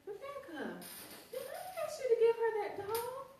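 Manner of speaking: put-on high, sing-song coaxing of a child; sweet coaxing. Teacher coaxing a little girl to give back a doll, high and sweet. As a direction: talk a toddler round gently.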